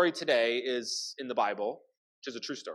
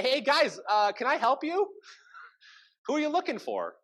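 higher, breezy mock-friendly greeting with a laugh; mock innocent; 9 semitones higher than usual. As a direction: play it innocent, like a helpful host. Elisha greeting the blinded army.

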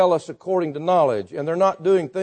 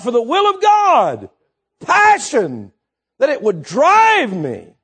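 impassioned preaching; 7 semitones higher, 7 dB louder than usual. Real, not acted. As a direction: preach it with rising fervour. Preaches at full force.

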